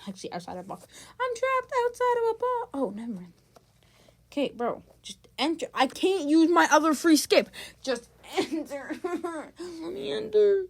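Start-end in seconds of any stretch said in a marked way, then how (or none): 1.1-2.7 high-pitched mock lament; melodramatic wail. Corrects own melodramatic complaint. 7.8-10.7 mock pleading. Pleading with game to admit player.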